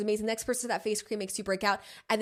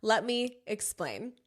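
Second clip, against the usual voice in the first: usual speaking voice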